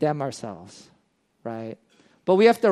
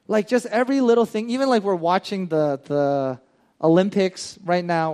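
usual speaking voice

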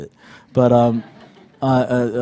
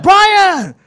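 high, loud delighted shout of a name; Brazilian master; 9 dB louder than usual. Retold or imitated. Brazilian master greets his student at the gym, excited yell. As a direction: imitate a coach hollering in a Brazilian accent. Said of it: "he had this thick Brazilian accent".

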